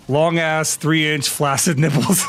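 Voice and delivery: normal talking voice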